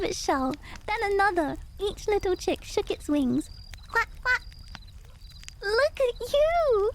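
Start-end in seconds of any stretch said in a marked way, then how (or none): 3.9-4.4 put-on chirpy baby duck quacking, pitched well up; high duckling quacks. A narrator's reading. Newborn ducklings greeting the world, high little quacks. 5.6-6.9 high, slow, delighted exclaim; joyful cry. A narrator's reading. Mama Duck greeting her hatchlings, joyful slow high cry. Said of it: "cried Mama Duck with joy".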